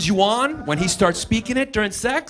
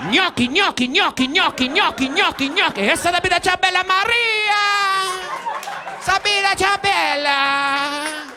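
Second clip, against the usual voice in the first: mock Italian; 7 semitones higher than usual. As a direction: sing out like an operatic Italian lover.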